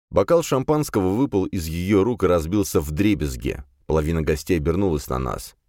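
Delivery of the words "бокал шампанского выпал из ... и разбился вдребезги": plain reading voice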